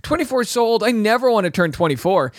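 put-on high fast dramatic teen; lightly voiced shocked teen; teen self. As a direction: gush it like a horrified nineteen-year-old. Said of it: "I was like".

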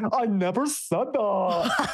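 high, drawn-out comic mimicry; Zach's catchphrase voice. Co-host mocking a reality star trying for his moment, high slow whine. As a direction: stretch it out way up high. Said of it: "he's like".

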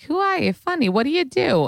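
very high-pitched old-lady impression; elderly dermatologist. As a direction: imitate an old woman bluntly sizing someone up. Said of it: "the eighty-year-old derm who was like".

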